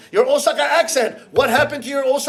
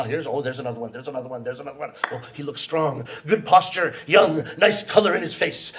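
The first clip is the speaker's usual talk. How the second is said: usual speaking voice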